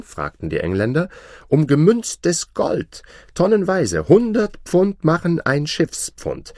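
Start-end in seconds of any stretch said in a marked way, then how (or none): 1.5-6.5 high, loud offer of a wager; boastful Englishmen. Acted. Englishmen offer gold to the farmer, high and boastful. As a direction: pile up the stakes, pitched high.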